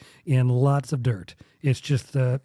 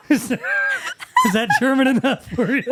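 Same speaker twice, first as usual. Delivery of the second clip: high, quick, through laughter; amused, laughing challenge; 9 semitones higher, 3 dB louder, faster than usual. Real, not acted. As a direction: ask it while cracking up. Laughing after his shouted attempt.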